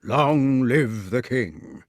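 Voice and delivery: very slow, grave proclamation; solemn. A narrator's reading. Sir Grummore proclaims the new king, solemnly. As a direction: intone it ceremonially, word by word. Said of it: "said Sir Grummore solemnly".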